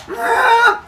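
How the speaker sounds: very high comic yell; cartoon burst of effort; imitated straining. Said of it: "and just go".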